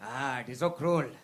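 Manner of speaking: Indian slave master dad. Retold or imitated. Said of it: "the old Indian slave master".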